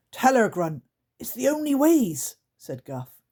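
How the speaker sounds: desperate goblin plea; pleading creaker. Acted. Guff begs Grunt to tell Lucy, high pleading creature voice.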